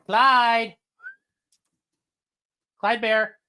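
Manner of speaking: high call across the room; high call to a wandering dog. Real, not acted.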